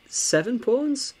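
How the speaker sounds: seven pawns? rising high and drawn out slowly; surprised question. Real, not acted. Counting pawns in disbelief.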